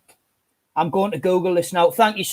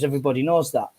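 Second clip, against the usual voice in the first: ordinary delivery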